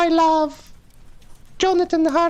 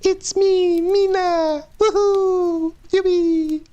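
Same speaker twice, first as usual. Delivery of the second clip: Mario. Acted. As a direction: squeak it out like a weird video-game mascot. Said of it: "sound like a weird Mario".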